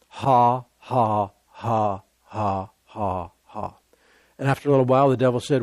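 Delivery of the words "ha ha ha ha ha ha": spaced-out mock laugh, dying away; imitated laugh. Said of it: "he just laughed".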